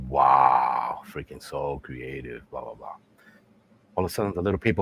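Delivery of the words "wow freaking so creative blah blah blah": low, slow, unimpressed wow; mock sarcasm. Wife scorns her husband's gift, low and sarcastic.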